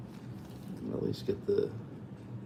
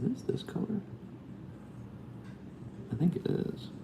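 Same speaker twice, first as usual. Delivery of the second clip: whispering; 12 semitones higher, 9 dB quieter than usual. Real, not acted. Quietly checking a paint color.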